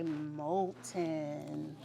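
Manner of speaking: very slow, drawn-out, low melting voice. Shown as a demonstration. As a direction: stretch the word as if your body is melting. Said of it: "pretend to melt your body".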